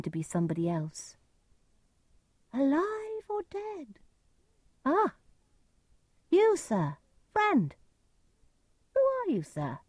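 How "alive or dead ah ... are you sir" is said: very high put-on voice of a stranger; disguised stranger's voice. A narrator's reading. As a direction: call out high, pretending to be somebody else. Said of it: "Edgar used a different voice to pretend".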